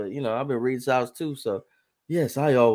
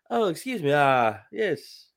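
high, prim polite mock-British voice; proper English kid; 4 semitones higher than usual. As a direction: ham up a dainty English boy asking for toast. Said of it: "Who is this English".

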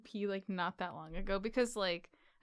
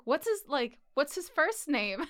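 staff voice; 6 semitones higher, 4 dB louder, slower than usual. Retold or imitated. Dentist staff voiced in retold story.

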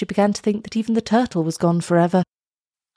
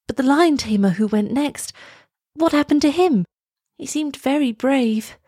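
put-on curious young girl; 7 semitones higher than usual. A narrator's reading. Elsa asks eagerly.

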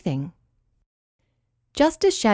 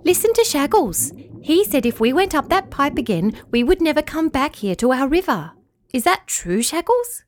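Flugia's voice; 7 semitones higher than usual. Acted. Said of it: "Flugia insisted".

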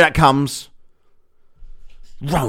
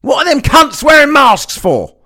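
much higher, loud shouted bird jeer; mock bird shout; 9 semitones higher, 5 dB louder than usual. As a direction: yell it down at the humans. Bird shouting at masked humans.

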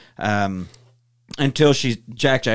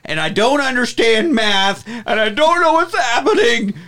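high, frantic rant; frazzled dad snapping at his kids; 7 semitones higher than usual. Retold or imitated. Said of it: "snaps and goes off on a rant".

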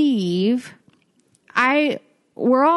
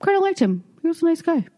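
imitated parents; 5 semitones higher, much faster than usual. Voices parents praising the man.

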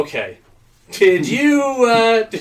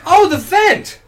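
surprised exclamation; 8 semitones higher, 5 dB louder, slower than usual. Acted. Second man echoes the discovery.

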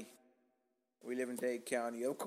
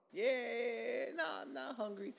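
high, drawn-out "yeah", then a softer brush-off; high dismissive reply; 9 semitones higher, 3 dB quieter, much slower than usual. Retold or imitated. Diner telling the waiter he's not hungry, high dismissive reply. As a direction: wave off the offer in a high voice.

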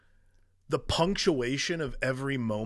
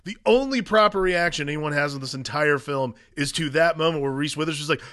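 ordinary delivery

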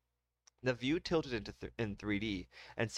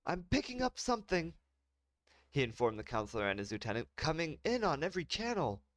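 alarmed officer; 7 semitones higher than usual. Acted.

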